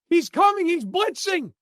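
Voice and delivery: high, loud alarm call; mock screaming. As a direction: yell it across the field, high-pitched. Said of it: "should be screaming on the field".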